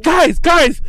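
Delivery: half-laughing plea to stop fighting; exasperated call. Retold or imitated. Clerk yelling at brawling coworkers, exasperated.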